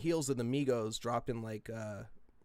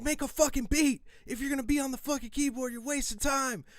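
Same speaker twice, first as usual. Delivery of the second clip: frantic rant; 11 semitones higher than usual. Retold or imitated. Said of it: "I'm like".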